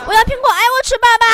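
high, loud call down the phone; acted pestering cry to dad; loud insistent demand. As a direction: shout it like a child demanding a treat. Pleading louder to dad by phone.

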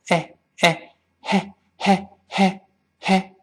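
short separate syllables, "嘿" with a light airy h; throat wide open, air flowing out on "嘿". Shown as a demonstration. Teacher drills the English h, "嘿" in short open-throat puffs. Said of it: "注意喉咙完全打开".

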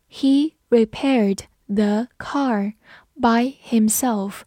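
slow, with a gap between words; slow read-along. Shown as a demonstration. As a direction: lead learners through it slowly. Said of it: "慢慢来读". (慢慢地逐词朗读)

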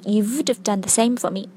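"have" said as a light "of" in "You would have done"; "have" reduced and unstressed, as a teacher's model; weak form of have. Shown as a demonstration. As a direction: run "would have" together as "would-of". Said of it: "要发的是have的弱读形式".

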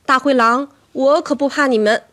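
high, loud, defiant voice; threatening challenge. Acted. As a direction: stand up to them loudly. Demonstrates threatening the wolves.

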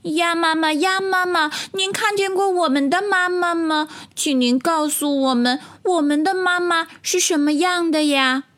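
put-on high-pitched childlike tadpole voice; little tadpoles asking. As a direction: ask politely as a tiny tadpole, high. Tadpoles question mother duck. (小蝌蚪用稚嫩高音询问)

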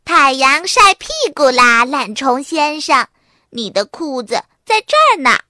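put-on high, loud teasing child voice; a bratty little sister waking him; little sister calling. D.W. teasing Arthur awake, high loud child voice. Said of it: "多拉喊着".

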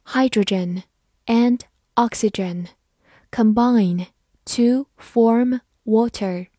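"Hydrogen and oxygen combine to form water" in slow, separated words; slow reading. Shown as a demonstration. Teacher reads the sentence for learners to follow, slow reading. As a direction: pause between words, giving "to form water" room. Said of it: "好，慢慢来读".

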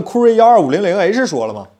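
ordinary talk, nothing marked

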